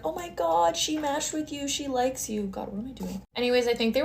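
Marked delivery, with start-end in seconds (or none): 0.1-2.4 high, teasing hype voice; a light hammed-up impression of the guys gushing to him; excited gossiping dorm boy, lightly voiced; mocking immature guys. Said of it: "guy and be like".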